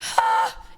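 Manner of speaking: high, breath-heavy sigh of disbelief; exasperated sigh. Real, not acted. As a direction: let out a big fed-up breath. Exasperated after the story.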